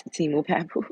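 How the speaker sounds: usual speaking voice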